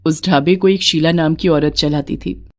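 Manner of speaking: plain reading voice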